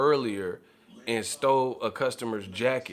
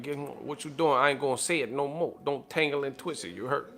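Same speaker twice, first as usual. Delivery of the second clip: imitating a New Orleans manager scolding; a hint of a New Orleans manager telling someone off; New Orleans accent; 3 semitones higher than usual. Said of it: "kinda like a N O accent".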